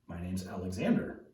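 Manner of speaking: put-on low, soft sheepish boy. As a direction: answer quietly, voice lifting unsurely at the end. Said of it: "kind of sheepishly and he says".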